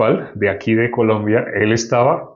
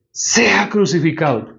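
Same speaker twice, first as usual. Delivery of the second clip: lightly voiced crowd demand, higher and drawn out; slow, raised cry of a crowd; crowd's cry; 6 semitones higher than usual. Preacher reads the crowd's answer to Pilate, mild cry.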